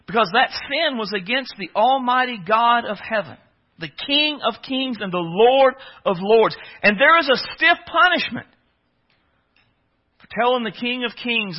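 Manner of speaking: loud, high, emphatic sermon delivery; full-force preaching. Real, not acted.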